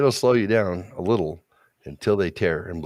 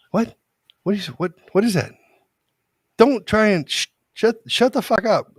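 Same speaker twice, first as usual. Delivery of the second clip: high, loud mock exasperated outburst; acted-out daughter losing patience; shocking outburst; 7 dB louder than usual. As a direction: snap at a parent, voice way up. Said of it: "which is shocking 'cause sh-, she doesn't talk like that".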